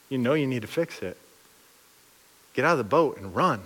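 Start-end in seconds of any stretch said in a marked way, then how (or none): none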